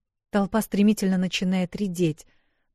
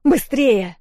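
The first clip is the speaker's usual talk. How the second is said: loud, higher urgent shout; 7 semitones higher, 8 dB louder than usual. A narrator's reading. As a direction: shout for her to hurry. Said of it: "ору".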